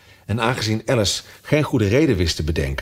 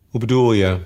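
curt. A narrator's reading. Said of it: "zei de rups strak".

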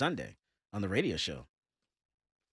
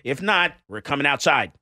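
loud warning; 5 semitones higher, 4 dB louder than usual. Real, not acted. Yelling at trash diggers outside.

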